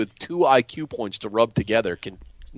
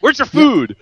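higher, louder snap of a gatekeeper; mock brusque demand; 10 semitones higher, 7 dB louder, slower than usual. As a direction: bark it like an officious employee. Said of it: "leaps out in front of her and says".

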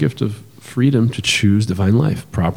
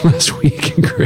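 laughing; slower than usual. Real, not acted. Correcting himself, amused.

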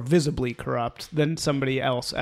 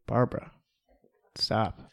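softer, lower "stop" after a pause; quiet, weary-sounding telling-off; deadpan reproach; 5 semitones lower, 6 dB quieter than usual. Retold or imitated. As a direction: shut it down drily. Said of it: "And I'm like".

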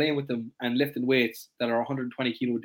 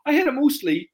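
evasive client; 11 semitones higher, 5 dB louder than usual. Retold or imitated. Coach acts evasive client.

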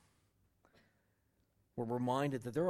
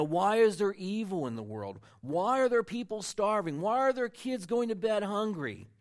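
friend's questions; 6 semitones higher, 7 dB louder than usual. Retold or imitated. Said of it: "they were asking him".

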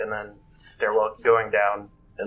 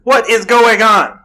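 loud high indignant yell; hollering; 11 semitones higher, 10 dB louder than usual. Acted. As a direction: bellow it out in outrage. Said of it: "I'm just gonna holler out".

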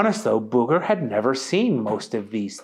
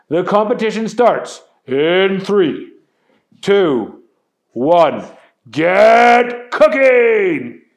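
louder and louder to a huge call; countdown shout; 6 semitones higher, 8 dB louder, slower than usual. A narrator's reading. Chet starts the contest, building to a loud shout.

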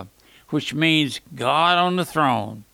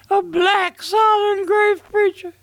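much higher, astonished repeat of a claim; amazed, raised-voice question; incredulous echo; 4 dB louder than usual. Retold or imitated. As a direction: echo it back in high-pitched disbelief. Echoes pastor's claim in disbelief.

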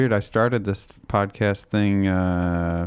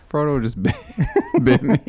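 laughing; 7 semitones higher than usual. Real, not acted. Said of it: "Wow, that was kinda loud".

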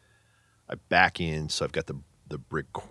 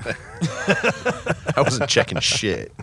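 laughing; 12 semitones higher, 7 dB louder, much slower than usual. Real, not acted. Laughing at the press release spin.

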